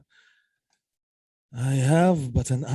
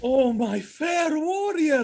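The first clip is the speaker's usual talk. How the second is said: high, slow, overjoyed call to his wife; joyful reunion cry; 12 semitones higher than usual. Acted. Husband greets his wife arriving in Cyprus, overjoyed high call. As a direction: pour out delight at seeing her again.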